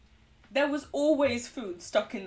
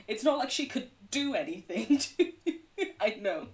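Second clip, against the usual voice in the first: laughing through it. Real, not acted. Laughing about toothless old dog.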